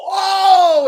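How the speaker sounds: high, loud gasp of wonder; amazed gasp. Retold or imitated. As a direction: gasp like a thrilled moviegoer. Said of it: "to go".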